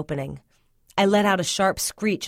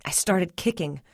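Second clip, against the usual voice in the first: usual speaking voice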